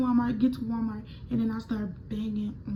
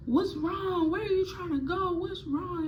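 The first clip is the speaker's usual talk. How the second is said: worried man; 8 semitones higher than usual. Retold or imitated. Worker voiced asking.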